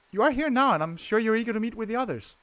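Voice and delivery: high, polite servant voice; courteous maid, lifted voice; maid Sophia. Acted. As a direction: pitch it well up as an eager maid.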